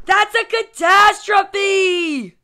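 slow, loud, high wail; dramatic outcry. A narrator's reading. Boy hearing toys must go, loud melodramatic protest. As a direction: blow it up into a disaster.

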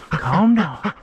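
high, gentle hush for the dog; soothing pet voice. Real, not acted. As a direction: coax the dog to settle.

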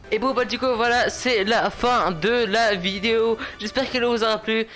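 high, upbeat sign-off patter; YouTube outro. Real, not acted. Gamer asks viewers to like and subscribe, high outro voice. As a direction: pitch it up and rattle through the channel plugs.

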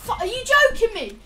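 frantic yell at teammates; panicking gamer. Real, not acted. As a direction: shout in disbelief, high and loud. Teammates not reviving, gamer yelling.

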